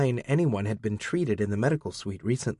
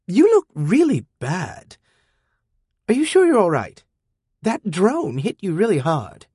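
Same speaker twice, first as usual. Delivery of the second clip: put-on high, loud worried teen; Amina, pitched up high and concerned; teen girl worried about the sec unit's injury; young girl voice; 8 semitones higher, 4 dB louder than usual. A narrator's reading.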